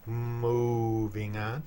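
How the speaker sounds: very slow, mooing first syllable; cow-joke moo. Pretend. Repeating the cow joke to co-hosts, long mock moo. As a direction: draw out "Moving" like a cow's moo. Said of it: "That's a cow joke".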